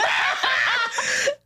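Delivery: squealing laugh, high and bright in pitch; high burst of laughter. Real, not acted. Laughing hard at her friend's awkward date.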